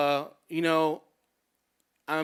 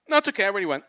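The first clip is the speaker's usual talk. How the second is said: quick, louder, higher brush-off; oblivious reply; 4 semitones higher, 8 dB louder, much faster than usual. Retold or imitated. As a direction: blurt it out, innocently missing the point. Cheerfully oblivious reply in a retold date.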